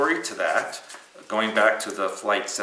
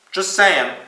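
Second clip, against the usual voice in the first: cheeky aside; 10 semitones higher, 11 dB louder than usual. Real, not acted. Cheeky aside after joke.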